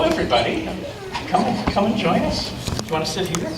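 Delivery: plain reading voice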